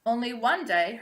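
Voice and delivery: ordinary delivery, nothing marked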